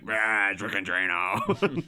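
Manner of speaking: slow, garbled Batman imitation; lightly hammed-up movie roar, drawn out; mock overdubbed voice. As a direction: draw out a nonsense superhero roar. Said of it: "And he's like".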